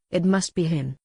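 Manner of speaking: plain reading voice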